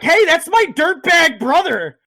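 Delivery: high, loud cry of recognition; excited call. Retold or imitated. Mission spotting her brother in the prison, excited high call. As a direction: point him out with a yelp. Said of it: "And Mission's like".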